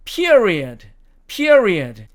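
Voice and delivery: PERIOD as "PEE-uh-ree-uhd"; British period. Shown as a demonstration. Teacher showing British pronunciation, PERIOD with an uh glide. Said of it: "느림표가 어로 변하니까".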